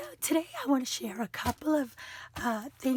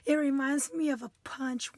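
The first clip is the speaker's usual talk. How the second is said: normal talking voice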